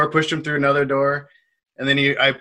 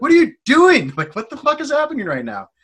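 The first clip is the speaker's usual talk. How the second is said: loud, high-pitched disbelieving yell, retold; alarmed confrontation; 12 semitones higher, 7 dB louder than usual. Bartender catching a regular about to hit someone, alarmed. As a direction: re-enact a startled shout that eases in volume.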